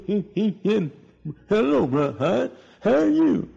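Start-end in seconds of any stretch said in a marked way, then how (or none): 1.5-3.5 high, strained, halting imitation of an afflicted man's speech; imitating Urban's speech. Preacher imitating Urban greeting him in strained, halting speech. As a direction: mimic the man's labored greeting. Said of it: "that's the way he talked".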